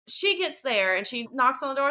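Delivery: ordinary talk, nothing marked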